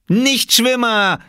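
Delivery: high, drawn-out shout; jeering call. A narrator's reading. Small boy jeering at boys in the lake, loud call. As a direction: holler the insult slowly across water. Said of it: "rief er den Jungen im Wasser zu".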